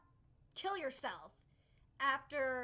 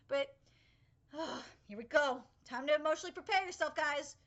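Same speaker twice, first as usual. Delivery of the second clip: ordinary delivery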